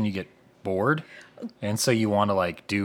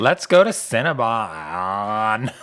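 Dwight voice; 7 dB louder, much slower than usual. Acted. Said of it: "You just sound like Dwight".

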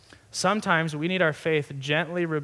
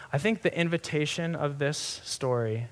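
usual speaking voice

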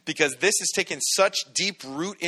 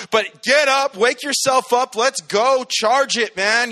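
rallying shout; 8 semitones higher, 6 dB louder, slower than usual. Real, not acted. Preacher rallying the congregation.

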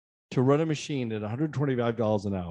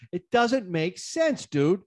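high, slow, indignant; exasperated; 6 semitones higher, much slower than usual. Retold or imitated. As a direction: spell out your disbelief. Retold angry phone call.

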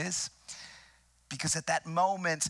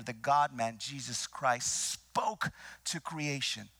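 usual speaking voice

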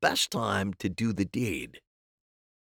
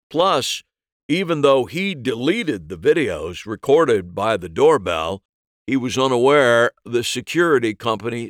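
forceful, loud cop voice; a detective laying out evidence; 3 semitones higher, 7 dB louder than usual. Acted.

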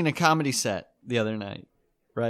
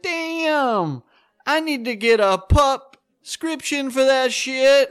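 comic voicing the dog wanting more pills; mock dog voice; 10 semitones higher, slower than usual. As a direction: play the dog hammed up. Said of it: "I'm like".

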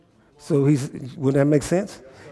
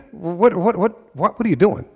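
higher, stammering mock indignation; upset, acted; 5 semitones higher than usual. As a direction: stumble over a baffled protest. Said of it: "I'd be upset too".

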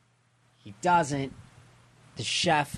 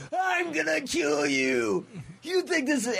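villain threat. Retold or imitated. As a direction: bellow it like a raging villain, pitched way up. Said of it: "he's like".

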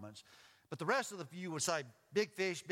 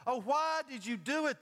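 higher, louder anguished question; groaned reproach; 10 semitones higher, 6 dB louder than usual. A narrator's reading. Sailors groaning at the runaway Jonah, dismayed. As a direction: lament it as a dismayed narrator. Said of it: "they groaned".

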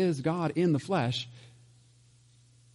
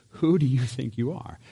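mocking. Shown as a demonstration. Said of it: "I do kinda say it that way".

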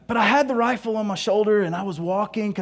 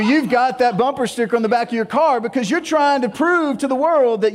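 ordinary talk, nothing marked

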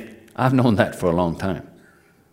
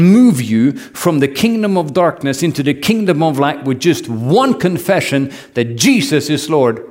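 fervent preaching; 2 semitones higher than usual. Acted. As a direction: drive it home to the congregation. Preacher building to full force.

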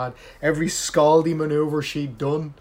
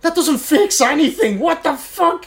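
high, loud outraged rant; exasperated; 12 semitones higher, 8 dB louder, slower than usual. Real, not acted. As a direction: vent your disbelief. Ranting about a TV character.

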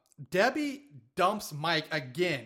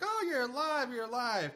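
high, excited cry of relief; mock joyful reunion; overjoyed relief; 8 semitones higher than usual. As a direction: gush it out, thrilled he's alive. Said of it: "He's like".

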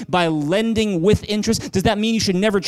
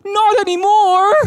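put-on bitter heartbroken girl; 14 semitones higher, 6 dB louder, slower than usual. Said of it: "She's like".

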